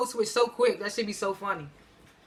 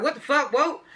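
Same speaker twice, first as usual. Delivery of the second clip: retold startled outburst, pitched up; mock alarm, jumping higher; fired up; 5 semitones higher than usual. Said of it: "put a little fire under Tariq".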